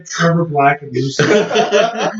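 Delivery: high, loud, slow through real laughter; laughing. Real, not acted. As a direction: crack up while repeating the names. Cracking up at the fake names.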